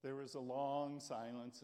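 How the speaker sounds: ordinary talk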